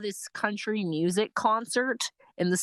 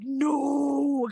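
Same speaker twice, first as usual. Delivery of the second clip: mock shout; 6 semitones higher than usual. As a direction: burst out no. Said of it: "I was like".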